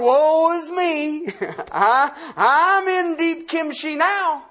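slow hammed-up whimpering; comic woe-is-me cry; mock lament. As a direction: overplay the misery for laughs.

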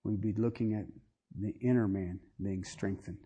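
soft, low, reverent pastoral prayer; closing prayer. Acted. Pastor praying over his brothers and sisters, hushed and reverent. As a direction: pray quietly over the people.

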